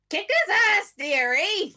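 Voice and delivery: high, loud granny cheer; old lady cheering. Retold or imitated. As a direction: egg him on like a cheeky grandma. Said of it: "bloody Helena be like".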